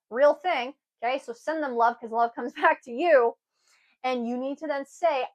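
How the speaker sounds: usual speaking voice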